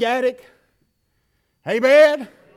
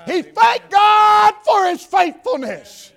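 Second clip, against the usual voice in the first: much higher, loud, slow shout; preaching shout; 10 semitones higher, 9 dB louder, slower than usual. Acted. Preacher shouting praise to the congregation. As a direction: throw the line out at full force.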